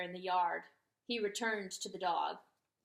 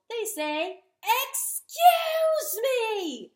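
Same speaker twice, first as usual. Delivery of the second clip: screeching, drawn-out high "Excuse me!"; top of his lungs; 13 semitones higher, 12 dB louder, much slower than usual. A narrator's reading. Turkey bellowing at the dog, slow and loud. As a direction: stretch "Excuse me" into a huge screech. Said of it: "at the top of his lungs".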